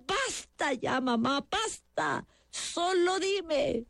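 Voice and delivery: high, slow, pleading outburst; son's strained, drawn-out outcry; mild mock yell. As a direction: cry out at your mother in despair. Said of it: "interrumpió a la mamá gritándole".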